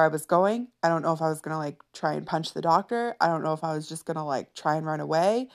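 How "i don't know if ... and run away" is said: normal talking voice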